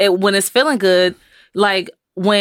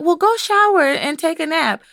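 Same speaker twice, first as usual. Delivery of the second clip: higher-pitched imitation of a mom; coaxing mother; 9 semitones higher than usual. Mother sending an amped-up kid to shower, coaxing. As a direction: sing out mom's playful suggestion.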